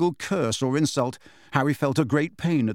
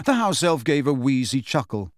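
ordinary delivery, nothing marked

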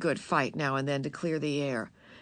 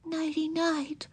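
tender; 11 semitones higher, slower than usual. A narrator's reading. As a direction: say it tenderly, like to a pet. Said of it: "she said tenderly".

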